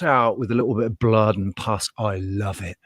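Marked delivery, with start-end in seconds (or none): 2.0-2.8 softly, almost to yourself; a muttered aside; quiet murmur. Real, not acted. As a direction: drop it low and soft. Repeats line, quiet then raised.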